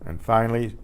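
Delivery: normal talking voice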